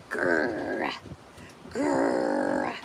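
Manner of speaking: deep playful growl; low pretend dog growl; low mock growl.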